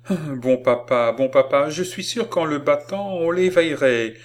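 put-on high, loud childish insistence; eager pleading. A narrator's reading. Girl hanging on grandfather's neck, eagerly pleading. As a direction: plead excitedly like a little girl.

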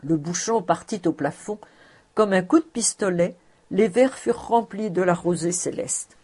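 usual speaking voice

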